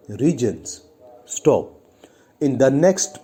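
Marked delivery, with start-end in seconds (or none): none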